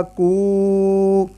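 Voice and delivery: کو held as koooo; long koo. Shown as a demonstration. Teacher demonstrates the long vowel. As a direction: draw کو out. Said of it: "کاف پیش وا".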